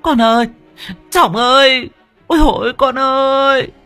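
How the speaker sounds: high, loud wailing for her son. Acted. Mother wails after her son Trọng as he flees. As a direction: wail his name like a grieving mother. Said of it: "một bà mếu máo gào khóc".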